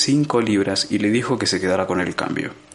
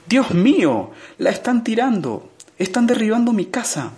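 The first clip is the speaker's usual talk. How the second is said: high, frantic cries of alarm; frantic outcry; 12 semitones higher than usual. Acted. Arthur watching his house torn down, frantic outcry. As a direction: panic climbing as he sees it happen.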